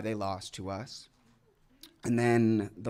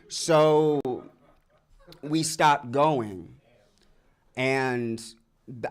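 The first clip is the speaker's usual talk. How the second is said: ordinary talk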